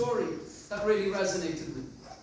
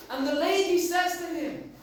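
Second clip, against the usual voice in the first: ordinary talk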